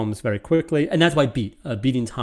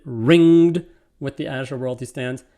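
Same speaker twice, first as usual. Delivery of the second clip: "ringed" punched louder and slower; stress on ringed; 3 semitones higher, 7 dB louder than usual. Shown as a demonstration. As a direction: open loud and slow on "ringed". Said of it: "It could also be done this way".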